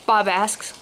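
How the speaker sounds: usual speaking voice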